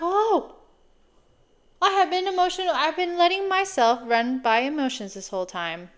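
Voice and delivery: a startled epiphany; sudden realization. Retold or imitated. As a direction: gasp into the discovery that it was all emotion. Sudden realization retold to listeners.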